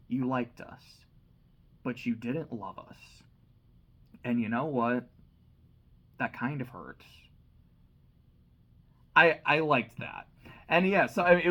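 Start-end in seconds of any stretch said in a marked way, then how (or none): 0.1-7.0 understated hurt, trailing low and quiet; quietly hurt reply. Retold or imitated. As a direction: let the hurt sink in, softly. Grace's hurt reply, retold.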